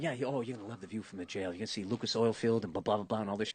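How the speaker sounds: low, soft smug taunt; smug gloating. Retold or imitated. Taunting the comedian about his jail view, low and smug. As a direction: gloat quietly like a bully. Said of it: "he's going".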